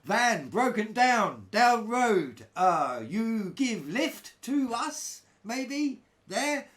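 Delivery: high, slow, broken-English pleading; pidgin English. Retold or imitated. Stranded travellers asking a German biker for a lift, slow high pidgin. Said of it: "we try and explain in our best pidgin English".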